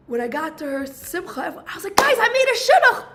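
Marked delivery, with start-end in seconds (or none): 2.0-3.1 high, loud, laughing announcement; excited announcement. Retold or imitated. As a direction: burst out with the happy news through a laugh. Announcing her news to everyone.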